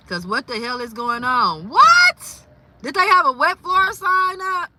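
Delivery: loud, high, indignant; outraged disbelief. Real, not acted. Outraged about a viewer's fall with no wet floor sign. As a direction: demand answers for her.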